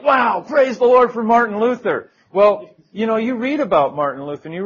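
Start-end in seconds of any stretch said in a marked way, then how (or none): 0.0-2.1 loud, high burst of praise; zachwyt zgromadzonych. Acted. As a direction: exclaim it loudly, voice lifted high. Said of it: "we're all like".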